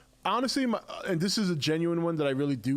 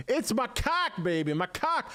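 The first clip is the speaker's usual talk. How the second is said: high mock Boston "macaque, baby"; playful Boston accent impression; 9 semitones higher than usual. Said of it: "is from Boston".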